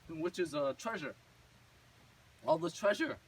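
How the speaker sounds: ordinary delivery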